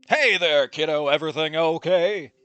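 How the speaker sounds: loud, hearty father on the phone; hearty dad. Acted. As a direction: call out a big fatherly greeting. Acts Dad answering the phone.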